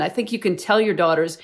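ordinary delivery

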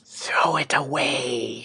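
a hoarse witch's whisper; old woman whispering. A narrator's reading. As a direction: breathe it out like an old hag. Witch hands back the flower.

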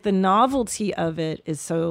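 usual speaking voice

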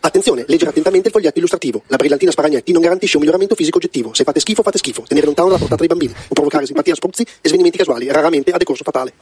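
lettura velocissima da spot; avvertenze lette veloci. Acted. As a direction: leggi le avvertenze di corsa. (avvertenze in fondo allo spot, lette velocissime)

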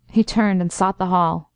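usual speaking voice

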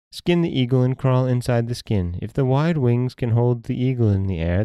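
mother in a dream. Acted.

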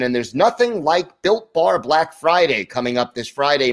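ordinary talk, nothing marked

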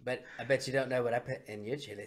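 low, hushed cartoon schemer; Cartman. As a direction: gloat softly, setting up the nasty reveal. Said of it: "And Cartman's like".

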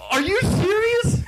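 high, loud, slow shout; disbelieving yell. Real, not acted. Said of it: "Are you yelling into the mic again?".

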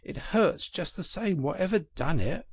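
high, plaintive grumble; mildly hurt, high-voiced protest; pained. A narrator's reading. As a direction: complain from pain, pitched well up. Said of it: "forgetting his grammar in his pain".

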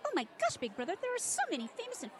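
high, excited squeal of awe; gushing kitten. Acted. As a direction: gasp it out like a starstruck kid. Kitten gushes at celebrities.

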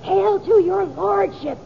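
high slow prompting; feed him the line slowly; stage prompt line. Shown as a demonstration.

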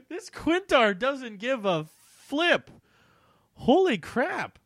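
high, slow, loud incredulous laugh-talk; amused disbelief. Real, not acted. Reader stunned by her old story, amused disbelief. Reacting to the callous line.

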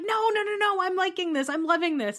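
high, excited mock Ramona; imitating Ramona. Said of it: "you know Ramona's like".

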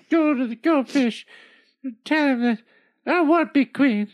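angry bossy queen. Acted. Queen orders husband.

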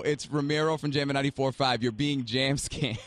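ordinary talk, nothing marked

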